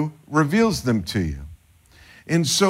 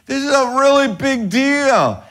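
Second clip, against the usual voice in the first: higher, louder, pressing the point; excited insistence; 10 semitones higher, 5 dB louder than usual. Retold or imitated. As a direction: make them feel how big it is. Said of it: "You're like".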